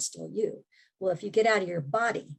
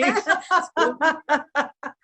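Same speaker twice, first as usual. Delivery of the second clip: laughing; 9 semitones higher, 5 dB louder than usual. Real, not acted. Both laugh at the pun.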